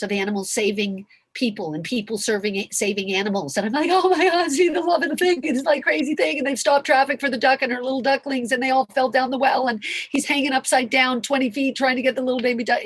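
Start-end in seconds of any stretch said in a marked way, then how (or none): 3.9-12.8 high, loud, breathless run of wonder; excited gushing. Retold or imitated. Retelling animal rescue videos to the audience, excited gushing. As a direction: gush it out high and loud, barely pausing.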